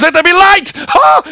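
high, loud cry capped by "Ah!"; exultant proclamation. Acted. Preacher giving God's command of light, exultant cry. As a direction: proclaim it at the top of the voice.

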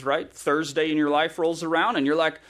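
usual speaking voice

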